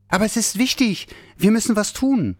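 little Santa insisting, high and urgent; urgent plea. Acted. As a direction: press the point urgently.

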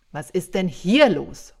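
loud roar over a commotion; bellowed shout. A narrator's reading. Santa to his chaotic Christmas workshop, bellowing. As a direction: roar it so the whole workshop stops. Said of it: "brüllte ich mitten ins Tohuwabohu".

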